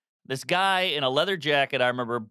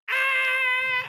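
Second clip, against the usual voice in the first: high piercing scream of shock; both boys screaming after the man hits the road, panicked; freaked-out scream. Retold or imitated. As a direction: scream like you just saw a body roll. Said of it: "I'm just freaking out".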